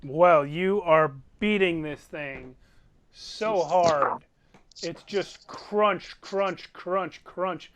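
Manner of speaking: loud, punchy game master storytelling; game master combat narration. Acted. Game master describing hammer blows to players, emphatic narration. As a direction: narrate the beating with heavy emphasis.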